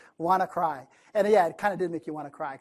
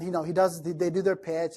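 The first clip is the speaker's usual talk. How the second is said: ordinary talk, nothing marked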